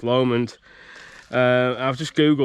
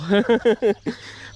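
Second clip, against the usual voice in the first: high burst of laughter; real laugh; 9 semitones higher than usual. Real, not acted. Laughing while telling the camera about the terrible Chinese.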